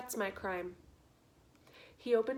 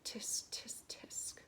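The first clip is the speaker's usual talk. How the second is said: whispered disapproving tisk; rat's tisk-tisk whisper; 11 dB quieter, slower than usual. A narrator's reading. Rat whispering tisk at the prisoner. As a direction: softly click a tisk tisk. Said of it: "said Roskorow".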